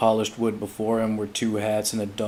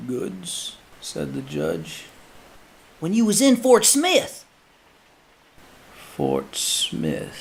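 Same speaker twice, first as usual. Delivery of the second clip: understated judge; 8 semitones higher, 3 dB louder, slower than usual. Acted. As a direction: ask it flatly, unbothered. Judge and man exchange.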